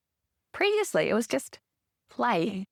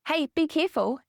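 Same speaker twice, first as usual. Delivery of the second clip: higher-pitched, falling caution; acted parent's heads-up, pitched up; parent cautioning child; 7 semitones higher than usual. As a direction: lightly call a caution to a child.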